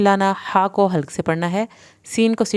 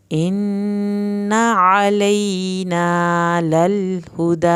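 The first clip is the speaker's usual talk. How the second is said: slow, drawn-out tajweed chant; Quranic recitation; much slower than usual. Shown as a demonstration. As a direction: recite each word slowly, melodically. Recites verse.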